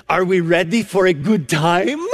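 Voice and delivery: put-on grandmother's Romanian-accented question, lilting up at the end; put-on Romanian accent with a rising finish. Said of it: "she says in this unique accent".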